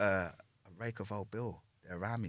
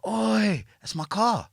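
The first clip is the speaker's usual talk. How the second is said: imitating a stranger yelling, much higher and louder; retold scream; 13 semitones higher, 10 dB louder, much slower than usual. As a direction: belt it out like the angry car owner. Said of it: "someone screamed out".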